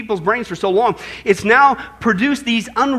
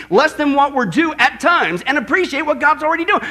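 normal talking voice

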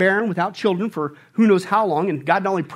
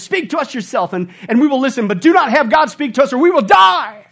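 fearful plea; 7 semitones higher, 9 dB louder than usual. Acted. Israelites begging Moses, read.

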